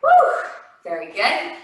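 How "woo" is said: excited whoop. Real, not acted. Cheering mid workout.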